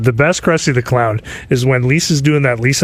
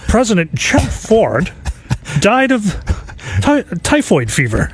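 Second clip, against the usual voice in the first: higher, slow anchor read; newsreader intro; 8 semitones higher, slower than usual. Acted. Mock news intro for the skit, slow high anchor read. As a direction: announce it gravely like a news anchor. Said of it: "voice over a whole bunch of different scenarios".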